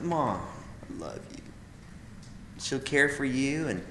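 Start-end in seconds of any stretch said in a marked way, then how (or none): 0.0-1.4 much softer, slow loving words to mom; tender to mom. Retold or imitated. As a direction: say it gently to mom. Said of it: "and you just like".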